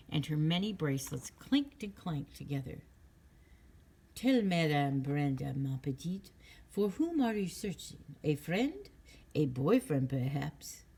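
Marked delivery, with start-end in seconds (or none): 4.2-10.8 lightly voiced French fortune teller, slow and theatrical; a hint of mysterious French charm; French accent.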